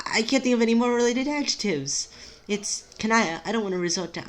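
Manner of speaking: high-pitched, anxious character voice; high, exasperated distress. Acted.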